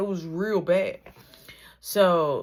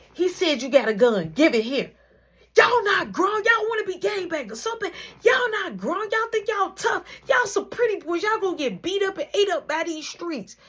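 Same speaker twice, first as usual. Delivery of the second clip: furious mom; 11 semitones higher than usual. Retold or imitated. Mom yelling at her sons about the BB gun, furious. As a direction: tear into the boys about the gun.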